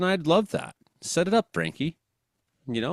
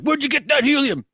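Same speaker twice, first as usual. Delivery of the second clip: cop voice; 13 semitones higher, 4 dB louder than usual. Retold or imitated. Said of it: "the cops are on 'em like".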